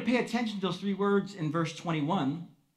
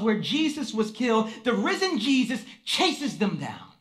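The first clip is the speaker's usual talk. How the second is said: ordinary talk, nothing marked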